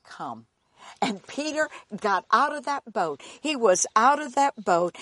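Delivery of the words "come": very low and soft; a quiet call in a deep voice; low calm command. Acted. Jesus calling Peter out of the boat, very low and soft. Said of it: "he said".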